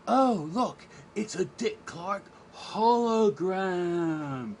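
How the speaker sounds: high, drawn-out wonder at the sight; hammed-up high-pitched awe; mock amazement. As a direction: marvel loudly, stretching out the last word. Acting out a retold show character.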